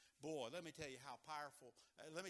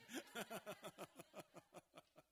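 laughter; 6 semitones higher than usual. Real, not acted. Congregation laughs at Eeyore remark.

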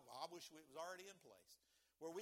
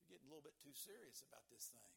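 much softer hesitant objection; doubtful churchgoer; 5 semitones lower, 13 dB quieter than usual. Retold or imitated. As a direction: mutter a nervous objection. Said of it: "somebody say".

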